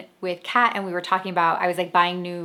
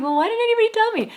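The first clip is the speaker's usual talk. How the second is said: much higher, louder, fast exasperated plea; exasperated complaint; 5 dB louder, faster than usual. Retold or imitated. Daughter complaining to her dad, a high exasperated squeal. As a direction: squeal it out in disbelief.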